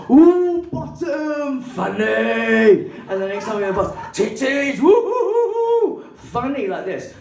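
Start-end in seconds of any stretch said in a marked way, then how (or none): 0.1-2.9 high, loud, singsong naughty cooing; mock saucy whoop. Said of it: "Flipping my skirt up and going". 4.2-6.7 high, rising, hammed-up saucy whooping; rude mock hooting. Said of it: "So I'm being really rude".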